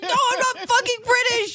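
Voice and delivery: put-on very high, squealed British-accent denial; over-the-top British outrage; indignant mock British. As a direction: protest shrilly in a hammed-up British accent.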